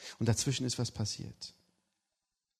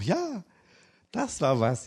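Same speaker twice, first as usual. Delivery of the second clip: high, laughing yes; a giddy yes with a laugh; 7 semitones higher, 4 dB louder, slower than usual. Retold or imitated. Wife's delighted yes, retold.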